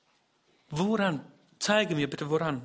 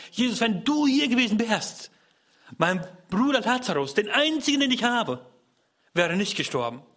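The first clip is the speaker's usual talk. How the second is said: higher, louder grieving reproach; anguished lament; 7 semitones higher, 4 dB louder than usual. Acted. Martha reproaching Jesus for her dead brother, anguished lament. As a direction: cry it out as a grieving sister.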